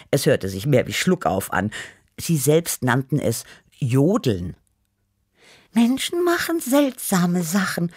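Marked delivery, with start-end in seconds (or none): 5.7-7.9 high, musing little cat voice; Tibi. A narrator's reading. Cat Tibi puzzling over odd humans, musing high. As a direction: ponder it in a small high voice. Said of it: "überlegte Tibi".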